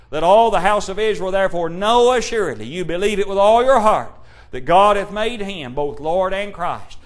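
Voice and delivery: loud, ringing pulpit proclamation; preaching at full force. Acted. Preacher proclaiming Christ's power to the congregation, full-force preaching. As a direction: thunder it out over the congregation.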